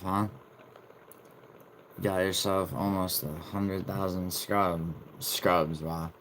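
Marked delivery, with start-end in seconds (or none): none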